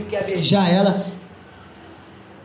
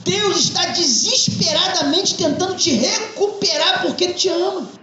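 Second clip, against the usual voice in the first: raised, ringing pulpit voice; fervent preaching; 8 semitones higher than usual. Acted. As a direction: preach it to the congregation with conviction. Pastor preaches to congregation.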